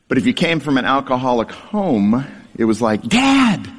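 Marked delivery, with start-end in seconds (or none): 3.0-3.7 put-on eager child calling out Dad; happy little shout for Dad; excited call. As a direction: call out to him with joy. Child greeting returning father.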